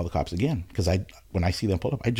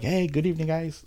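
higher, sing-song "Hey, good evening" to calm cops; overly sweet hello, hands up; extra-friendly greeting; 8 semitones higher than usual. Retold or imitated. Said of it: "like that".

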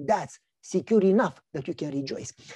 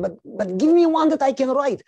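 put-on higher and louder, a small boy pleading; little boy begging; 8 semitones higher, 7 dB louder than usual. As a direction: beg like an eager three-year-old. Son begs father for bike.

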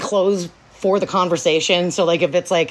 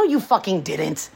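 normal talking voice

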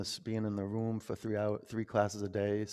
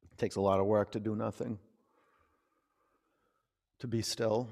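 yoga cool-down guidance. Acted. Yoga teacher guiding the class into final rest, calm. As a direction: ease the class toward stillness. Leads students into rest pose.

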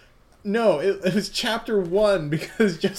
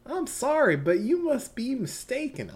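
Southern Belle; 8 semitones higher than usual. Acted. GM voicing Mitsuba to Yasuho as a sweet Southern belle. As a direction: play the genteel Southern lady, all honey. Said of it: "doing her Southern Belle".

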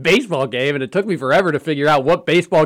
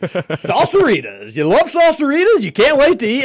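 high singsong ad read; commercial voice; 9 semitones higher than usual. Retold or imitated. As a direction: sell it like a cheesy ad. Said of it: "in the background, it's like".

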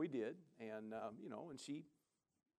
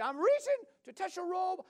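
put-on much higher, louder voice of the woman in her accent; imitating the woman; 9 dB louder than usual. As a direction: lift into her high, earnest voice. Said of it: "But she".

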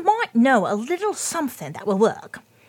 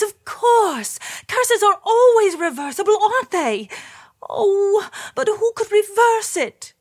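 cartoon princess; 7 semitones higher than usual. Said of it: "cried the princess".

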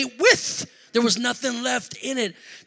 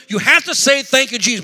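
louder, pushy retold command; imitating a firm wife; insistent; 4 semitones higher, 6 dB louder than usual. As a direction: press him to comply.

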